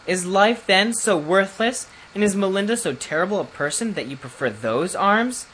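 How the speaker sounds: hurt lady. A narrator's reading. Melinda, hurt by the prince's preference for death, pleading. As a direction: plead with wounded dignity.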